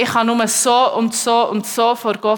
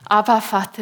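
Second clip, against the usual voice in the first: ordinary talk, nothing marked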